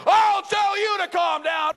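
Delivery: very high, loud, slow bellow; furious shout. Retold or imitated. Angry customer yelling at the manager, furious shout. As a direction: roar it back at her. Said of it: "He said".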